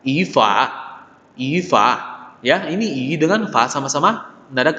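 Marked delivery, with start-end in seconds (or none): 0.0-1.9 I rising before fa in Ifa; tone change to second. Shown as a demonstration. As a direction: lift the first syllable of Ifa. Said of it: "pembacanya jadi semua nada kedua".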